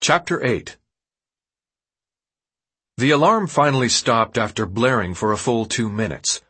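ordinary delivery, nothing marked

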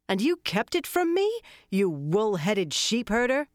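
sharp, high, loud outraged demand; angry demand. Acted. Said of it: "Min demanded".

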